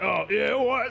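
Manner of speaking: flustered NPC. Acted.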